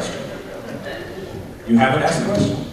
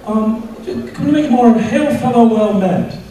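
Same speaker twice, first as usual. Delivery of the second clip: director asking timidly; 8 semitones higher, slower than usual. Retold or imitated. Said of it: "Mister Shatner, if we could".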